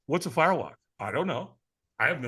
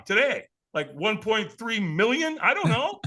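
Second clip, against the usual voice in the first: ordinary delivery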